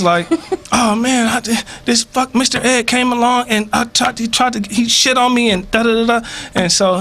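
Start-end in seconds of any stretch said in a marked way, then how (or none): 0.7-6.3 higher-pitched, put-upon acted griping; aggrieved complaint. Bird telling the tomcat what Mister Ed did, aggrieved complaint. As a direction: spill the grievance, pitch raised throughout.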